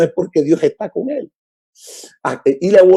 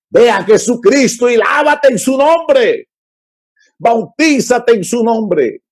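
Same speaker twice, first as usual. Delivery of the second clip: fiery preaching shout; 7 semitones higher, slower than usual. Real, not acted. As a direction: call the blind to come and wash. Preaching Jesus' call, shouted.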